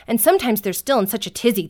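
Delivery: normal talking voice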